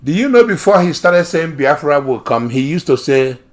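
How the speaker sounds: ordinary delivery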